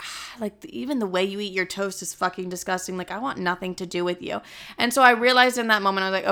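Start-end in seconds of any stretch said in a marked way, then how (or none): 0.0-4.4 soft, muttered disdain; quiet inner grumble. Retold or imitated. Looking at ex over breakfast, quiet disgusted mumble. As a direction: mumble it under your breath, turned off.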